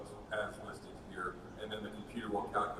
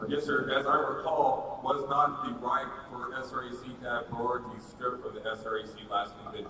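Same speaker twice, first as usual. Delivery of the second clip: loud; 8 dB louder than usual. Real, not acted. Insistent point of information.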